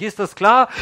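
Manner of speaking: loud, high, commanding question; a caller losing patience; forceful demand. Acted. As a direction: snap it like a command. Preacher's demand to secretary.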